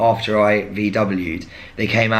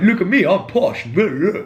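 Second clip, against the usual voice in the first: high put-on posh toff voice; mock posh voice; 10 semitones higher, 3 dB louder than usual. Mocking how critics think he sounds, posh put-on. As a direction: play the smug rich boy, nose in the air. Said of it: "Not me being like".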